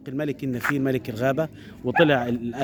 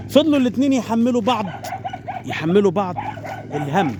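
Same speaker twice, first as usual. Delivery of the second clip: excited; 7 semitones higher than usual. A narrator's reading. Dramatic narration of blame.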